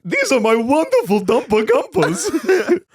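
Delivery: high, giddy Willy Wonka voice. Pretend. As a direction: play a giddy candy-maker host. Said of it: "the new Willy Wonka".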